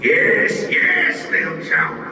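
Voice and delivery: gloating monster call; boss shouting. Acted. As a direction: welcome the prey mockingly. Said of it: "the boss shouted".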